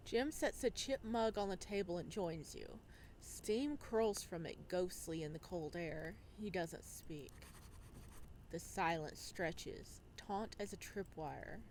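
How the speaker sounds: calm, measured storytelling voice; story narration. A narrator's reading. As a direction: read it like an audiobook narrator.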